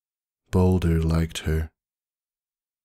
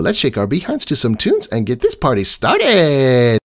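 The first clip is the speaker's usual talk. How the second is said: high, loud hollow cheer; forced party cheer; 7 semitones higher, 7 dB louder than usual. A narrator's reading. Pinkie tries to start a party for Maud, high and loud. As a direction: push the fun a little too hard. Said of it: "says Pinkie Pie as she heads".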